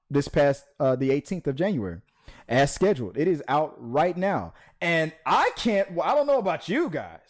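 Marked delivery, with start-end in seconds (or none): 4.8-7.2 dripping sarcasm about the book; sarcastic. Acted. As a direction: fake excitement you clearly don't mean. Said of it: "I am lying, of course".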